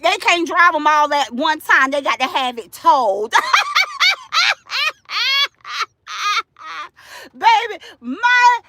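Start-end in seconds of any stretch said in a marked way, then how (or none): 3.4-5.8 shrill-pitched cackle after the punchline; high cackling laugh. Real, not acted. Laughing at her own joke.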